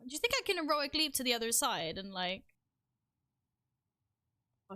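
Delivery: ordinary delivery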